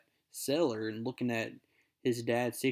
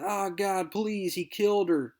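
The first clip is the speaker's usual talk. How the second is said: pleading; 7 semitones higher than usual. Acted. Harry pleads after the slap.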